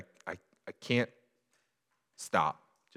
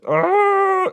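put-on high, loud child's cry; childlike wail; 15 dB louder than usual. Pastor reliving a child's wail on the floor, loud. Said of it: "like a small child".

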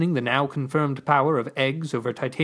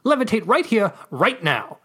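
high, loud demand; 7 semitones higher, 4 dB louder than usual. Acted. Chevron insists the narrator levitate at once, demanding. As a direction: order it on the spot. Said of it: "Chevron demanded".

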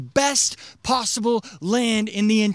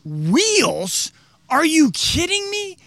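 amazed disbelief; 7 semitones higher, much slower than usual. Retold or imitated. Ancient people amazed by wheels.

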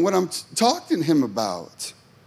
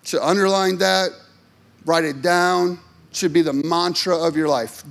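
ordinary delivery

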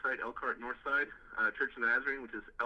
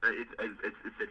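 normal talking voice

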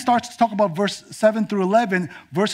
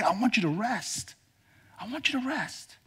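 higher and much softer, lingering; tender God; 4 semitones higher, 8 dB quieter than usual. Acted. God gently telling Israel to rest, softly. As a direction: let the invitation fade gently. God's invitation, repeated softly.

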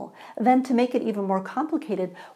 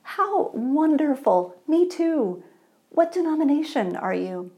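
delighted; 6 semitones higher than usual. Acted. Eager man questioning.

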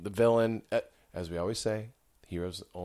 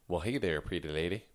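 Southern accent; 2 semitones lower, 3 dB quieter than usual. Shown as a demonstration. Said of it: "he's from the South".

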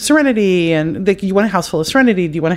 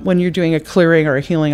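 ordinary talk

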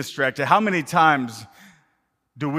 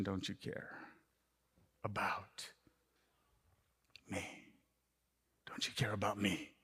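whispered plea; 6 semitones lower, 17 dB quieter than usual. Acted. Believer asking God privately, whispered plea. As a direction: whisper the question, much softer.

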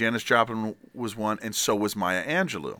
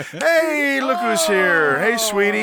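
excited greeting; 9 semitones higher, 5 dB louder, much slower than usual. Real, not acted. Friend arrives mid-sentence.

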